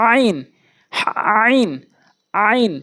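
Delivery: আইন squeezed from mid-throat; Arabic ain sound. Shown as a demonstration. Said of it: "হলকের মাঝখান থেকে".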